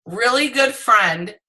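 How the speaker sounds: ordinary talk, nothing marked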